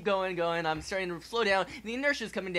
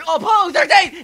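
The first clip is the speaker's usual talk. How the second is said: loud high mock shout; triumphant call; 9 semitones higher, 12 dB louder than usual. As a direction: cry out the mission name. Landing on the moon.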